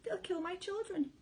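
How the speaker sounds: high, frantic cry of fear; mother's panicked cry; mother fox crying out in fear. A narrator's reading. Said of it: "cried Missus Fox".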